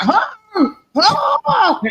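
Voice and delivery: high yelping mock bark; imitated dog bark. Mocking the Shih Tzu's bark, high yelping. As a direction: yip it like a tiny odd dog. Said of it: "that dog is like".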